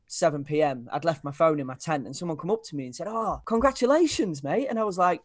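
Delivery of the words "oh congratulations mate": high, slow, gushing well-wisher; imitating an eager fan; mock excited congratulations. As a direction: play the overjoyed stranger. Stranger congratulates at festival.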